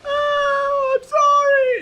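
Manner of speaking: hammed-up sob in a squeaky falsetto, loud; mock sobbing wife. Wife apologising to her husband, hammed-up crying falsetto. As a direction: wail the apology like a cartoon victim.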